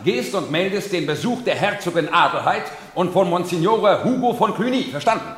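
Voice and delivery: loud, high calls to the servants; commanding orders. Acted. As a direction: give orders across the room. Steward barks orders to servants.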